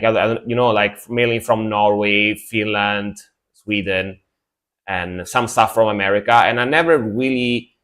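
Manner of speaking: usual speaking voice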